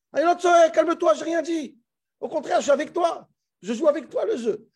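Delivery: high-pitched mock protest; indignant retort. Moshe answering back to Hashem, indignant protest. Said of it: "Si je suis Moshe, je dis quoi à Hachem?".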